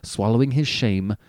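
ordinary delivery

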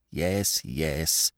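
low, soft grumble; grumbling. A narrator's reading. Wife answers her husband about the money, grumbling. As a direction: grumble it under your voice, unconvinced. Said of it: "she grumbled".

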